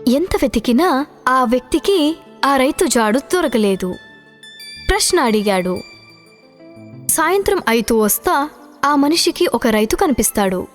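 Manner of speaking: plain reading voice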